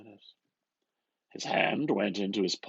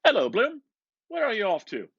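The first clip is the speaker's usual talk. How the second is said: much higher, louder hearty hail; McCoy's greeting; 12 semitones higher, 8 dB louder, faster than usual. A narrator's reading. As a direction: call out cheerily across the street. McCoy greets Bloom on street.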